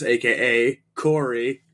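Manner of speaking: ordinary talk, nothing marked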